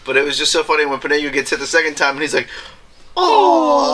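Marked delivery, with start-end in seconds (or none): 3.2-3.9 high, drawn-out mock wail; Pinocchio's wail. Retelling Pinocchio's second magic hit, high comic wail. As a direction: let a long high "oh" slide out.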